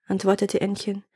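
usual speaking voice

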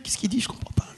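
puzzled stage whisper to a companion; whispered aside. Acted. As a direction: drop into a confused, secretive whisper. Confused aside behind polite front.

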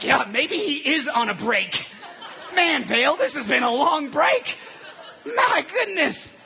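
loud, high-pitched acted complaint; hammed-up impatient hollering; mock exasperation. Voices duped worshippers in mock complaint.

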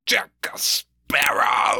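very slow, high-pitched villain impression; Davy Jones. Co-host imitating the villain's famous line, theatrical impression. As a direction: draw out each word in a theatrical growling call. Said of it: "The way he says Sparrow".

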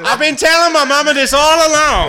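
high, loud gleeful crowing; high loud crowing, laughing. Real, not acted. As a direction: crow it like you won. Triumphant joke.

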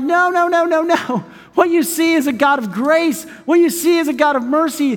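high, urgent sermon correction; insistent preaching. Real, not acted. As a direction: plead with them to see mercy. Preaches insistently against fear of God.

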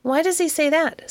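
lifted, wondering question; curious question. A narrator's reading. A friend asks curiously about the dog. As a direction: ask it with real curiosity, pitch up. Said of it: "asked Missus Montague curiously".

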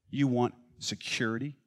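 ordinary delivery

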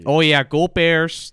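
slow, drawn-out Chicago fan cheer; root like a Chicagoan; Chicago accent. Acted. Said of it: "what is a Chicago accent".

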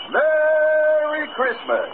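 high, slow, drawn-out Merry Christmas; sing-song jolly Santa voice; Santa's greeting. Acted. Santa greeting the emperor Nero, high and slow.

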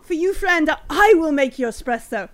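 loud, lifted theatrical offer; acted fawning host, emphatic; showy generosity. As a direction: make a grand show of hospitality. Stallholder makes a show of favour.

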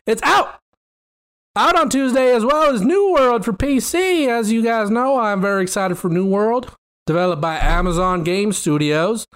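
hammed-up country announcer read; southern accent. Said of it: "let's just do it in a country accent".

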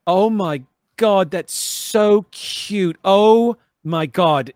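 high slow cooing; gushing. Acted. Tara gushing over the dino, slow high coo. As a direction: melt over the cute baby dino.

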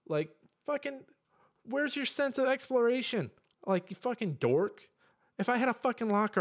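high, exasperated mock rant; hammed-up high-pitched ranting. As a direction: push the pitch up like a squeaky nerd bully. Ranting at gamers.

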